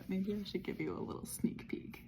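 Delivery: soft whispered aside; whispering. Real, not acted. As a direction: whisper it like a secret. Secretive sneak peek.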